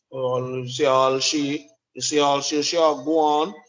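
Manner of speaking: mock complaining wife. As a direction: drag it out like a nagging partner. Voicing wife's complaint in quarrel.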